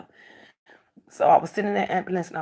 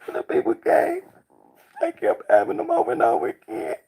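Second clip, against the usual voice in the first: high emotional voice; 11 semitones higher, 3 dB louder than usual. Real, not acted. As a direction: pitch up, voice straining high. Emotional retelling, voice rising high.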